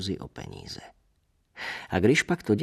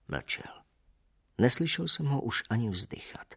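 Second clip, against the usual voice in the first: usual speaking voice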